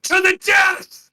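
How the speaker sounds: a melodramatic duel cry; dramatic shout. Acted. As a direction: bellow it, upping the stakes.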